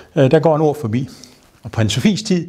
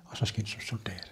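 whisper; 3 semitones lower, 19 dB quieter than usual. Retold or imitated. Countess sentencing the poachers.

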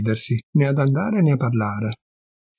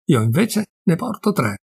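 ordinary delivery, nothing marked

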